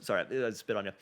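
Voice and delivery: usual speaking voice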